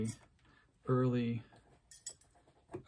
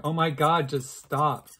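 louder exasperated plea from a viewer; mock viewer yell; 3 semitones higher, 8 dB louder, slower than usual. Viewer yells at the vlogger to stop, exasperated. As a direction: play the fed-up viewer yelling at the screen. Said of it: "you're probably yelling at the screen going".

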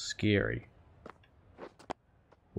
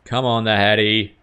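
louder urging; 8 dB louder than usual. Real, not acted. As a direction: Come on, the Hattie pushed out louder with hope.

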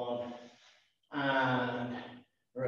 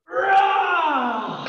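shouted roar; 12 semitones higher, 5 dB louder than usual. Pretend. Lion roar for kids.

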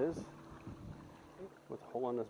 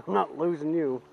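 loud, determined cry to the fish; excited shout while reeling in; 4 semitones higher, 12 dB louder than usual. Real, not acted. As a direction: fight the fish and won't let go.

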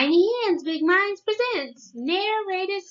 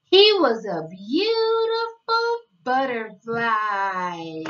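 storybook reading; 3 semitones lower than usual. Acted. Narrator reading a picture book to young children, sing-song storytelling. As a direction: read it like a bedtime picture book, savoring each day.

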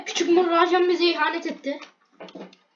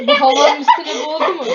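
loud, higher, breaking into laughs; laughing; 4 semitones higher, 8 dB louder than usual. Real, not acted. Player reacting to a ridiculous game moment, laughing. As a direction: talk through bursts of laughter.

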